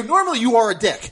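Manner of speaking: loud, high retold rebuke; acted loud insistence; emphatic telling-off. Retold telling-off of his buddy.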